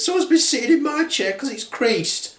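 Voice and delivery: high-pitched indignant mother bear; Mummy Bear. Acted. Mother bear about her creased chair, indignant. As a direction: complain in a put-on high voice. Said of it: "The Mummy Bear then says".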